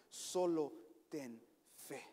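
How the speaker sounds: "Solo ten fe" drawn out very slowly, each word weighed; "Solo ten fe" said at half speed with heavy weight; slow emphasis. Shown as a demonstration.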